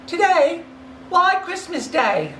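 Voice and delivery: put-on high, cheeky boy's voice; young boy. A street boy answering Scrooge, puzzled and cheeky.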